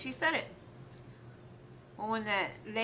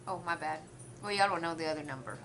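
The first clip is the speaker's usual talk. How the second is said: ordinary talk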